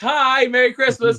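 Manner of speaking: high, loud, cheery holiday greeting; Buddy the Elf. Retold or imitated. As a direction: call out like an overeager Christmas character. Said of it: "when you're that character".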